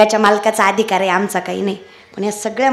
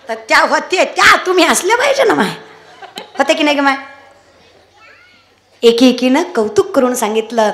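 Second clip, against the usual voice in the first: ordinary talk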